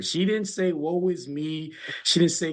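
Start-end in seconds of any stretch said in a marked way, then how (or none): 0.7-1.7 slow, higher-pitched mock lament; mock pity. Coach voicing what mother didn't say, mock pity. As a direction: draw it out in mock sympathy. Sympathy his mother did not give.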